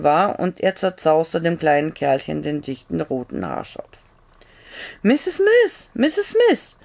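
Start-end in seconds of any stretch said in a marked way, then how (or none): 5.0-6.8 high urgent call from outside the house; a man at the back door calls for the doctor's wife. A narrator's reading. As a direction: call her name through the night. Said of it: "Irgendjemand hatte sie gerade gerufen".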